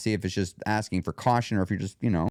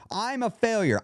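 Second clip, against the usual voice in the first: higher-pitched, harsher absolute statement; 9 semitones higher than usual. Shown as a demonstration. Showing listeners the difference, harsher absolute. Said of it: "one of them sounds harsher than the other".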